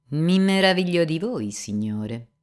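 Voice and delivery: affected. Acted. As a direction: put on a show of mannered wonder. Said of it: "con una certa affettazione". (con affettazione, più alto e forte)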